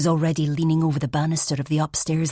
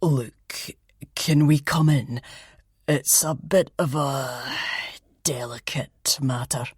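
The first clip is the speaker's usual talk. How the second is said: grave detective; slower than usual. Acted. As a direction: hush it as bad news coming. Detective asks to come in.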